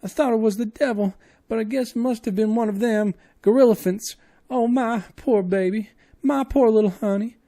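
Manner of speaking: very high, loud wailing lament; grieving woman wailing; sobbing maid. Acted. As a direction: cry out for her lost girl. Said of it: "broke into uncontrollable sobbing".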